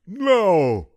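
put-on high, loud toothless old man shouting; angry shout. A narrator's reading. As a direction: bark it at the officer. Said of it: "shouted Grandpa angrily".

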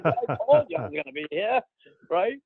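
high retort broken by laughter; laughing. Retold or imitated. Rookie answering the astonished veteran, laughing. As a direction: laugh through the retold comeback. Teller laughs through his own punchline.

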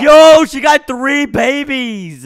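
loud slow amazed yo; astonished at her three babies; surprised shout. Real, not acted.

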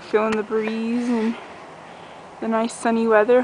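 ordinary talk

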